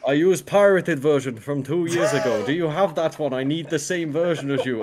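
slow mock Russian accent. Russian stranger explaining his software, slow mock Russian accent. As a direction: spell it out as a Russian producer. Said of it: "talking in Russian".

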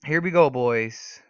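slow, loud announcement; rallying call. Real, not acted. Gamer to the boys before a fight, rallying call.

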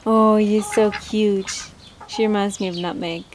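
ordinary talk, nothing marked

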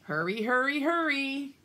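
ordinary delivery

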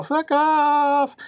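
high, drawn-out brush-off; mock Spartan refusal. Spartans refusing the Persian, comic high voice. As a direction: sing it out high and dismissive. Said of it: "and the Spartans like".

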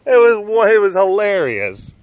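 high-pitched, tickled remark; amused exclamation. Real, not acted. Retelling a funny film moment.

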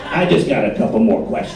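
low, probing detective impression; Columbo. Girlfriend grilling partner back from beers, detective impression. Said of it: "She turns into Columbo".

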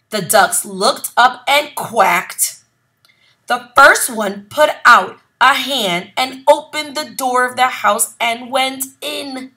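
ordinary delivery

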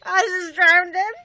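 high, loud dismay, dragged out; shocked. Real, not acted. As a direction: gasp in horror at your mistake. Accidentally drowning a character.

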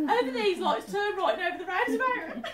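high, loud directions through laughter; laughing mock directions. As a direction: imitate a local giving directions, laughing. Laughingly acts giving directions.

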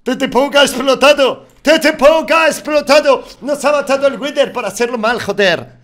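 high, loud outraged shout; grito de frustración. Real, not acted. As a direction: yell it out in disbelief. Muere en la pelea. (grito indignado tras morir en la batalla)

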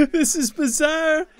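high, slow, laughing through the words; laughing disbelief. Real, not acted. Gamer weirded out by his Sim, high laughing. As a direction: squeak it out mid-laugh.

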